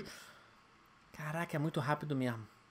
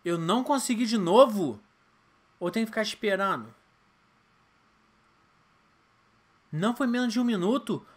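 loud exasperated gamer complaint; frustrated; 4 semitones higher, 7 dB louder than usual. Real, not acted. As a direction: complain loudly, disbelieving. Failed again, frustrated.

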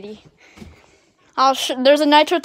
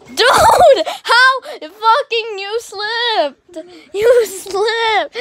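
high, drawn-out incredulous cry; exasperated disbelief; 7 semitones higher, 5 dB louder, much slower than usual. Real, not acted. Player to friend who just slipped, exasperated disbelief. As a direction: wail it in disbelief, stretching the words.

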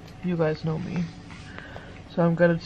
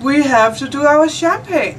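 excited; 8 semitones higher, 8 dB louder than usual. Real, not acted. Insists on champagne, high and slow.

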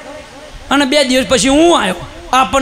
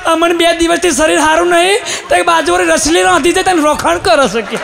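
higher mock wailing complaint; a lightly hammed-up tearful wife; crying wife; 4 semitones higher than usual. Wife complaining to husband about the neighbour, mock tearful. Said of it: "એને રોવાનું ચાલુ કર્યું".

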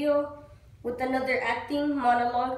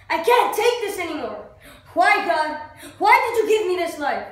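loud, higher-pitched cry to God; anguished outburst; 4 semitones higher, 7 dB louder than usual. Acted. Actor asks God why he gave him this life, anguished outburst. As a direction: rail at God, at the end of your tether. Acted monologue pleading angrily to God.

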